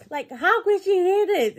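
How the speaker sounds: high, hammed-up disbelief; mock shocked. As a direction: play Gary caught off guard. Gary shocked he was overheard.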